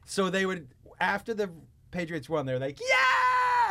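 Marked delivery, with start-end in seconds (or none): 2.8-3.7 high, loud victory yell; cheering shout. Retold or imitated. Fans cheering after the Patriots win, high loud yell. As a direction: cheer like a fan whose team just won.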